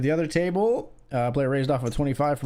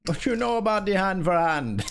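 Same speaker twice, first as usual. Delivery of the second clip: high mock Jamaican accent; hammed-up Jamaican, pitched up; 6 semitones higher than usual. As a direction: play up a Jamaican lilt, falling at the end. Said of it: "a Jamaican accent".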